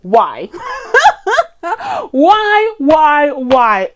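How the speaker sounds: hammed-up wailing why, high and loud; mock despair. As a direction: plead in mock anguish. Upset the film flopped.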